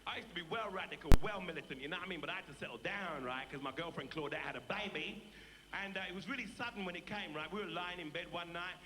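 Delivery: streetwise South London patter; chatty, boastful London street character; Delbert Wilkins, cocky Brixton lad. Acted. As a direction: play a swaggering Brixton wide boy.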